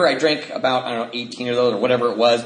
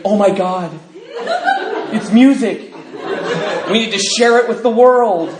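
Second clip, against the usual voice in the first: mock drunk awe; 6 semitones higher, 3 dB louder than usual. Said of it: "we hear our voice".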